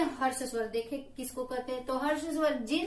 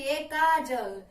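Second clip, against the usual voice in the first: slowly stretched calling out ए Kajal; ए Kajal pulled long as a pluta call; drawn-out call; much slower than usual. Retold or imitated. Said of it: "जैसे रिघाते हैं".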